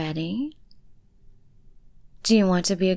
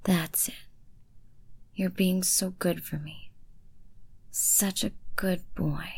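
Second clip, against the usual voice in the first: normal talking voice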